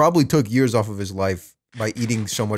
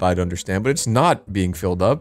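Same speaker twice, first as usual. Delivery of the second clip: ordinary talk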